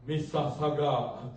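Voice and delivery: slow, loud mock prophecy; hammed-up booming decree; mock divine pronouncement. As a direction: intone it like a grand oracle, tongue in cheek. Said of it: "very seldom will the Lord say".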